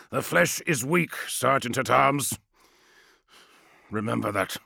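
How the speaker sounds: deliberate stern commander. Acted. Kratos's cold warning.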